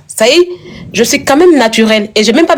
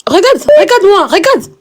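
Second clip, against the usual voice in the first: higher, eager "look at me"; insisting excitedly; 9 semitones higher than usual. Real, not acted. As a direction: grab her attention, high and pressing. Insists friend look at her. (elle insiste, voix plus aiguë et pressante)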